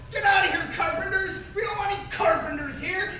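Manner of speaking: high-pitched, indignant mock outburst; angry bartender. Bartender ordering carpenters out in joke.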